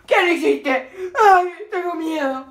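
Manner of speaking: half laugh, half cry; laughing scared cry. Real, not acted. (risa nerviosa llorosa)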